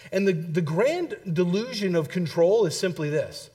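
ordinary delivery

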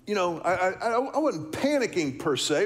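usual speaking voice

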